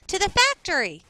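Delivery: high, loud calling out; eager classmate. Acted. Classmate shouting out an idea to the class, eager and loud. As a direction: pitch it up like a kid with the answer.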